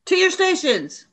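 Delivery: high, sharp barked order; rally the soldiers to their posts; sudden call to arms; commanding shout. Acted.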